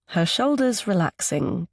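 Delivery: plain reading voice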